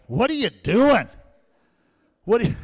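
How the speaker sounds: high, loud questioning brother; brother's question. Retold or imitated. Older brother finds the boy in the corner, high and loud. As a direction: call out at him, puzzled.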